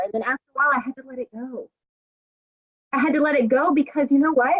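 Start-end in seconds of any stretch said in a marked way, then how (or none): 0.7-1.7 much softer, almost to herself; soft letting go. Real, not acted. Telling the group about the Jenny situation, hushed. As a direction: let it slip out quietly. Repeats the phrase, first softly.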